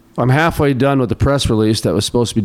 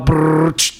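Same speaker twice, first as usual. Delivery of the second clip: trilled brrr of film whirring past; imitated machine whir. Host mimics scrolling through old microfiche, imitated whir. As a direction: make a rolling reel noise with the lips.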